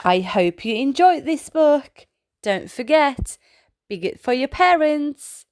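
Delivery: usual speaking voice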